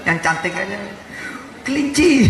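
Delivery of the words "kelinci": higher and louder, bright exclaimed word; cheerful shout. Pretend. The preacher swaps an angry curse for a cute word, shouted high. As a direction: blurt a cute word as a joke.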